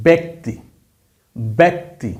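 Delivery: ব্যক্তি said as "bekti" with a clear "e"; pronunciation demo. Shown as a demonstration. Teacher to class, example word: ব্যক্তি said "bekti". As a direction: sound out ব্যক্তি for learners. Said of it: "যেমন এই শব্দটা".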